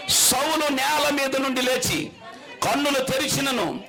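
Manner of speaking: slow, high, declaiming verse reading; preached scripture reading. Acted. Preacher reading Acts aloud to the congregation, declaiming slowly. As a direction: proclaim the verse to the hall.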